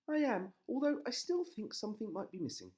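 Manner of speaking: high, loud wife voice; wistful high wife. A narrator's reading.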